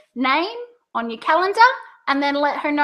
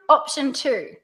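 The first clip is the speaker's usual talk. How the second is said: "two" falls to close; pitch down. Shown as a demonstration. Coach models the second option, pitch down. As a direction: bring "two" down, settling the choice. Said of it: "pitch goes down".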